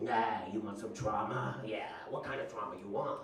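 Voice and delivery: low old-timey cartoon dealer voice; shady drug dealer. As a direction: sidle up and offer illicit goods. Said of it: "like, an old-timey cartoon, like, shady drug dealer".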